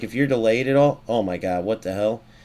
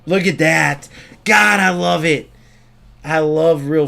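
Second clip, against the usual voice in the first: loud, higher burst of delight; thrilled; 5 semitones higher, 5 dB louder than usual. Real, not acted. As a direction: shout your delight at the perfect play. Thrilled by a perfect play.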